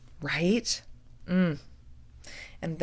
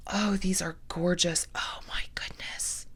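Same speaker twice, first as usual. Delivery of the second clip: whisper; 4 dB quieter than usual. Real, not acted. Admiring beads under breath.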